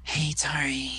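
breathy come-on whisper to Tori; seductive whisper. Retold or imitated. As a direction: whisper it like a temptress. Dawn Marie seducing Tori.

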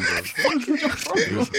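laughing. Real, not acted. Laughing at church story.

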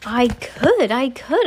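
eager, rising outburst; crying out. A narrator's reading. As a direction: cry it out with eager delight. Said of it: "I cried".

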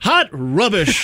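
hammed-up British disdain; mock British. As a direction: draw out the British slang as a verdict. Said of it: "I'm just gonna start saying".

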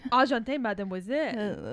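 playful French greeting; mock Parisian accent. Host echoing her friend, mock French accent. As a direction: flirt with a fake French accent. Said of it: "our Parisian accents".

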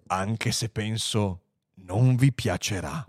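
low, slow warning; grave computer. Acted. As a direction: warn them with ponderous calm. Computer gravely warns the men. (avvertimento grave e lento)

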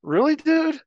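high, loud disbelief; exasperated, almost laughing; incredulous really dude. Retold or imitated. Narrator facing the guy's threat, loud disbelief.

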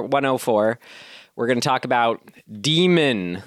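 ordinary talk, nothing marked

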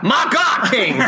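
high excited wrestling call, laughing; Jim Ross impression. Podcast host mimics Jim Ross calling a wrestling match, laughing. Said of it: "this guy sounds like Jim Ross".

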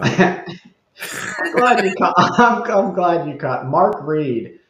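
words breaking up with laughter; laughing. Real, not acted. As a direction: talk through real laughter. Amused, laughs as he starts speaking.

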